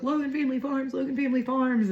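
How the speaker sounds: high-pitched imitation of a nagging husband; pestering husband. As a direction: mimic him pestering, high and insistent. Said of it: "I was getting annoyed with him asking me".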